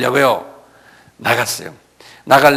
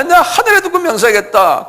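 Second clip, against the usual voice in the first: high-pitched insistent protest; vehement oath. Acted. Peter swearing by heaven to his accusers, vehement. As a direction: swear it with a raised voice.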